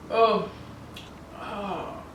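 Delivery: ordinary delivery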